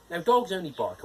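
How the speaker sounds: usual speaking voice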